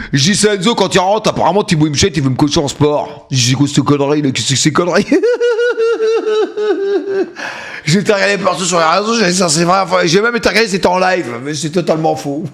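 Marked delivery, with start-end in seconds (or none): 5.1-7.8 high wheezing laugh; long helpless high-pitched giggle. Real, not acted. Laughing at the coaching rumour. (rire aigu prolongé)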